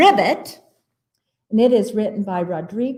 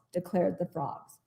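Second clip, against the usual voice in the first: ordinary delivery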